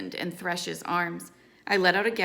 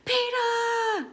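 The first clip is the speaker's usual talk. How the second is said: high, drawn-out narrator's scream; screamed name; 4 dB louder than usual. A narrator's reading. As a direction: cry the name out high and long, short of full volume. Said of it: "scream at the top of my lungs".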